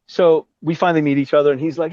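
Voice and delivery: usual speaking voice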